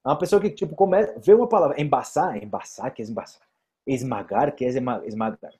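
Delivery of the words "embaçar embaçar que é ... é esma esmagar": softer, lower muttered puzzling; puzzled learner. Acted. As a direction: sound out an unknown word under your breath. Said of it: "não saberia". (aprendiz confuso, resmungando baixinho)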